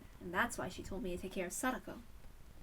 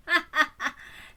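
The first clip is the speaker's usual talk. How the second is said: amused laugh; 6 semitones higher, 8 dB louder than usual. Acted. Amused laugh before speaking.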